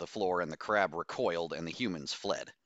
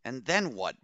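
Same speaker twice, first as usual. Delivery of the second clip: higher, pointed demand; 6 semitones higher than usual. A narrator's reading. Freed captive challenges her rescuers, demanding. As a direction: demand an answer, pitch lifted. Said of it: "Bose demanded".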